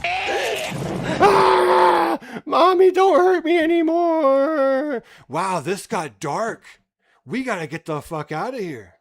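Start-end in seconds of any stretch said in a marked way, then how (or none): 0.0-5.0 much higher, loud terrified cry; terrified child scream. Pretend. Mock child screaming at Mommy not to hurt him. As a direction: scream and beg, slowly, like a beaten kid.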